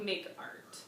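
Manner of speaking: ordinary talk, nothing marked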